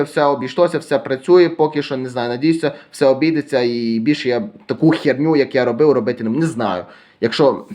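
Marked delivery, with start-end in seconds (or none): none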